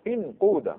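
انقود with long "oo": inquuda; second dialect form. Shown as a demonstration. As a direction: say انقود with a rounded "oo" after q. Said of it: "خلي همزه الوصل". (انقود بإخلاص الضم)

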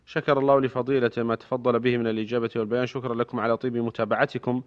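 formal radio announcer read; crisp, measured sign-off announcement; formal standard arabic. Acted. Radio host's closing announcement.

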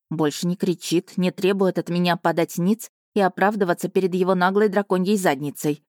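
ordinary delivery

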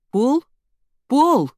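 put-on high, loud call for a missing boy. A narrator's reading. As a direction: call his name out, searching. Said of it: "Мама и тётя звали мальчика". (громко зовя мальчика)

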